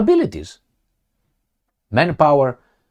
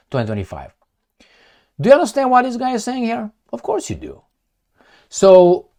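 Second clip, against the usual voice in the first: normal talking voice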